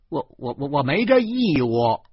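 angry retort. A narrator's reading. Narrator angrily refusing the policeman's demand. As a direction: sputter with rage. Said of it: "我怒气冲冲地说".

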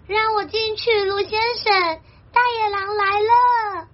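high voice pleading for shelter; high-pitched storybook rabbit in a panic; alarmed rabbit. Acted. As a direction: call out high, fleeing the wolf. Rabbit's plea in Chinese.

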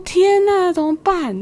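high, panicked cry of dismay; oh no, what now; dismayed gasp. Retold or imitated. Passenger seeing the bus gone, high panicked dismay.

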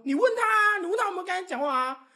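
high, loud retold comeback; student to teacher, defiant and loud; indignant retort. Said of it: "我就跟老师说".

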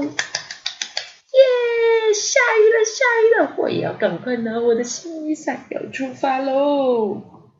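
squealing happy little bear; high thrilled cheer; excited. Acted. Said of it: "小熊熊好开心哦".

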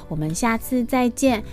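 ordinary delivery, nothing marked

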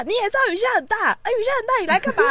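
high, exasperated scolding. Retold or imitated. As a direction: scold them in disbelief. Scolding parents in retelling. (气急地高声数落)

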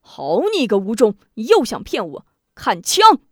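rising to a loud furious cry; angry challenge. Acted. Prince angered, charging Wu Zhong with a loud cry. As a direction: build from anger to an attack yell. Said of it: "气道".

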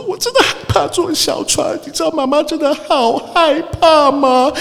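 high, slow, hammed-up weepy voice; mock tearful mother. Mother tells her son she fears small boats, hammed-up weepy voice. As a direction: overact a frightened mother's sobbing plea. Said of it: "然后就开始哭".

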